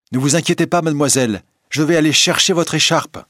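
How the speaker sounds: put-on high, loud eager little boy; a boy calling out, keen to help; boy's voice. A narrator's reading. Boy calling to Christine on the beach, high and eager.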